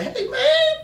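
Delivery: very high, slow crying shout; wailing high voice of the guy in the wagon; hammed-up shrieking fellow prisoner; prisoner squeal. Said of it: "the guy was like".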